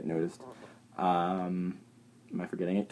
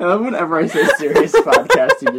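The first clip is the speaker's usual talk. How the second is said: laughing; 8 semitones higher, 8 dB louder than usual. Real, not acted. Laughing through the line.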